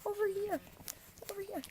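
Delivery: high coaxing call; calling the pups. Real, not acted. Owner calling puppies over in high voice.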